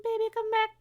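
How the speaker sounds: very high sing-song call; high coaxing call. Real, not acted. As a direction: coax the cat back in a high voice. Calling the cat back.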